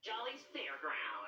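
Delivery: hushed announcer. Acted. Announcing track name slowly.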